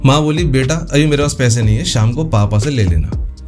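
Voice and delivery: ordinary delivery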